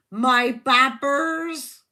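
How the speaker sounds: slow, loud Bristolian demonstration; Bristolian accent. Shown as a demonstration. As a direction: stretch it like a Bristol local. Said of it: "Bristolians often say".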